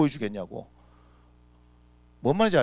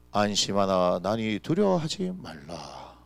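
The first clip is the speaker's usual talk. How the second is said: slow, lower, soothing words; calm reassurance; 8 semitones lower, 3 dB quieter, slower than usual. Acted. 겁에 질린 제자들에게 예수님이 건네는 느리고 낮은 위로. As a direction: settle frightened men gently and slowly. Jesus calming disciples.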